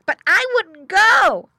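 high, loud, drawn-out piglet voice; boy pig. A narrator's reading. Boy pig Woof Woof continues.

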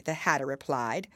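plain reading voice

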